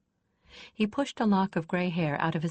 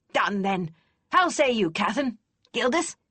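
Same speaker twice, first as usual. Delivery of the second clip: put-on louder, gruff old lady scoffing kindly; snorting, not unkind; 5 semitones higher, 4 dB louder than usual. A narrator's reading. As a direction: snort at the lad but keep it kind. Kester closing the deal.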